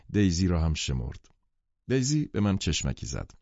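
usual speaking voice

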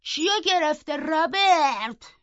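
high, stretched call from afar; shouted question. A narrator's reading. Linton calls to Robert from the doorway, shouting. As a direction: call out long and high to a servant. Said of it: "لینتون از دم در داد زد".

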